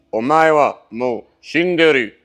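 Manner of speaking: loud, drawn-out anime-style line; Japanese voice. Acted. Quoting Japanese vocabulary to the camera, loud put-on Japanese voice. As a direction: declaim it like an anime fighter. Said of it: "faire les, les voix japonaises".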